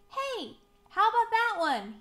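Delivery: high, loud, hopeful call; excited high-pitched storybook question; eager calling out. A narrator's reading. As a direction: call out on spotting just the thing. Spots a hat, calls out.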